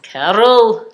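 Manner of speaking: put-on Irish accent. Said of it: "this Irish couple, Carol".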